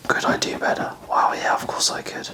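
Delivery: ordinary talk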